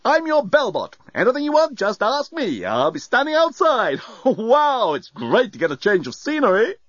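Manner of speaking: high, chirpy bellbot with a laugh; cheerful robot butler, easygoing; breezy robot voice. A narrator's reading. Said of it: "it said breezily".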